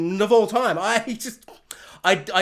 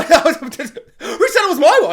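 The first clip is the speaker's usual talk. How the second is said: louder, higher, slow, laughing through it; old lady laughing off the war; laughing denial; 7 semitones higher, 7 dB louder, slower than usual. Retold or imitated. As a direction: giggle a guilty protest.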